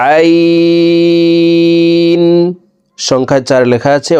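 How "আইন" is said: "আইন" from mid-throat, held very long on a high level tone; four-alif stretch. Shown as a demonstration. Teacher demonstrating ain from mid-throat, long drawn-out hold. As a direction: draw out "আইন" as one steady chanted note. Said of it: "আইনকে চার আলিফ টেনে পড়তে হবে".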